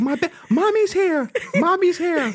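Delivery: put-on mother comforting her collapsed child; soothing frantic mother. Friend imitates the mother hovering over her daughter. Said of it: "she was like".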